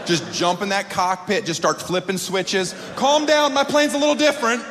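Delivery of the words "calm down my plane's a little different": fake pilot. Fake pilot to panicked passenger, high breezy bluff. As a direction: chirp confidently while clueless.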